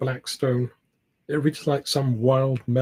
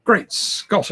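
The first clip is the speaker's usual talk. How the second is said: um grito alto e surpreso, com a voz bem mais aguda; surprised cry; 7 semitones higher, 3 dB louder than usual. A narrator's reading. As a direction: exclama de espanto, sobe a voz. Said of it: "I cried".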